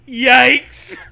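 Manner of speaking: loud high yikes; hammed-up loud yikes; mock alarm. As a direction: yelp it out in fake fright. Exclaiming alarm.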